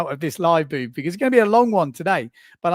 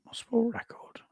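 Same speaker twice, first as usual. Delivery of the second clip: whisper; 6 semitones higher, 10 dB quieter than usual. Real, not acted.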